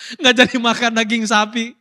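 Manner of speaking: loud, laughing through the words. Real, not acted. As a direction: tell it through a real laugh. Laughs at own anecdote.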